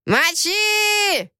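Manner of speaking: raw high feral raider yell; hoarse yell. Acted. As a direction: roar it hoarse and wild. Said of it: "хрипло заорал Болин". (хриплый вопль)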